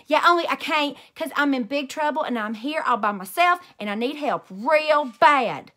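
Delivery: loud desperate pleading; pleading with the dispatcher. A narrator's reading. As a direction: beg for help, can't calm down. Panicked call to dispatcher.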